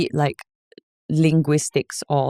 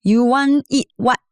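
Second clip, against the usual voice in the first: slow Singlish to grandma, pitched up; 7 semitones higher, slower than usual. Retold or imitated. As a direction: talk Singlish to your grandma, slowly. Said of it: "If I speak to my grandma".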